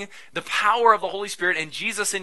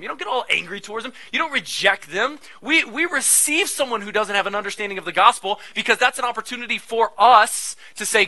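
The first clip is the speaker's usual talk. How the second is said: normal talking voice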